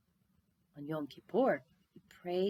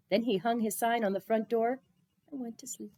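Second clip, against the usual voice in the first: ordinary delivery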